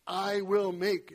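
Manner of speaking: "I will make" slow and loud on WILL; slow stressed I will. Shown as a demonstration. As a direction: drag out "I will make", punch will. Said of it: "I emphasized them as I read them".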